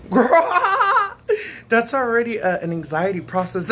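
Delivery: put-on loud, high laughing girl; laughing exclamation. Telling viewers about her celebrity fantasy, laughing. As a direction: squeal it through laughter.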